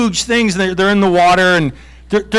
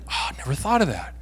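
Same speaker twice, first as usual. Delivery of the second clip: sheepish aside; 4 semitones lower, 10 dB quieter, faster than usual. Retold or imitated. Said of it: "And I'd be like".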